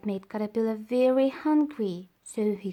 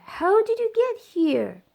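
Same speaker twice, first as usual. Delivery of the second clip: high, louder amazed question; surprised question; 10 semitones higher, 6 dB louder than usual. Acted. Caterpillar amazed the bee appeared, high surprised question. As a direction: ask in wide-eyed wonder.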